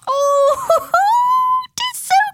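very high, drawn-out squeal through giggles; squealing little girl, shivery and giggly; giggling child. A narrator's reading. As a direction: cringe and giggle like a little girl at icy water. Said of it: "giggled Percia, cringing".

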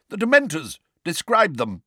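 loud, high, impatient demand. A narrator's reading. Judge demands a description from the witness, impatient. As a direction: press the witness impatiently for an answer. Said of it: "said Madam Bones impatiently".

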